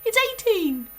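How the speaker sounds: put-on high, loud little boy cry; a small boy blurting a panicked guess. Rupert frantically corrects himself to the headmistress, high and loud. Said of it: "he cried".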